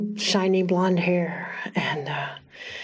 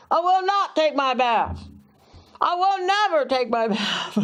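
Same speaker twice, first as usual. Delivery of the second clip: put-on defiant boy; 8 semitones higher than usual. Defiant boy voiced in story.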